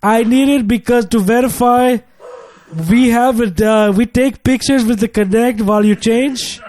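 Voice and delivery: put-on call-center agent in a lightly done African accent; mock African accent, slightly high. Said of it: "I couldn't do my African accent".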